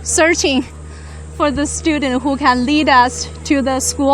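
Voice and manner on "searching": normal talking voice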